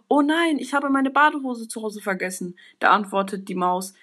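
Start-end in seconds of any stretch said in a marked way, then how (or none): 0.1-2.6 higher-pitched, mildly dismayed exclamation; startled elephant. Acted. Elephant realises the trunks are at home, startled. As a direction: start high with a shocked oh no, then ease down. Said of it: "sagt der Elefant erschrocken".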